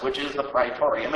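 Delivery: ordinary delivery, nothing marked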